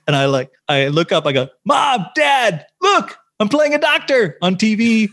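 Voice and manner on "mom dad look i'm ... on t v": excited shout. Retold or imitated. Son calling out to his parents from the makeup chair, excited shout. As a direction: yell it up proudly, laughing.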